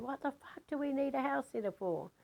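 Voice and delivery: indignant retold outburst without the volume; retold screaming. Arguing over a house sitter, mock screamed protest. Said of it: "still screaming".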